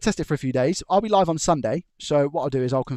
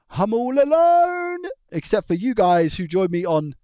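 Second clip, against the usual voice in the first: much higher, louder, slow stretched wail; mock lament; 9 semitones higher, 6 dB louder, slower than usual. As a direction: ham up a lonely cry. Self-pitying joke about being alone.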